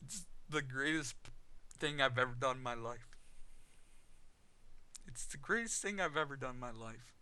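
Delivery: choked up as the feeling rises; getting emotional. Real, not acted. Telling viewers about coming out, real emotion welling up. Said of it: "I'm sorry I get emotional about it".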